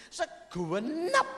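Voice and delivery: segenap drawn out as "seguwenap", slow and rising high; Javanese-style segenap. Shown as a demonstration. Preacher showing the Javanese way to say segenap. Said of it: "kalau orang Jawa lebih mantap".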